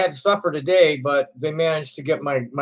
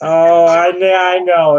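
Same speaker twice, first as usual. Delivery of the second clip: louder exasperated "I know"; loud annoyed recognition; 7 dB louder, slower than usual. Real, not acted. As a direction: snap back in recognition. Recognizes the caller.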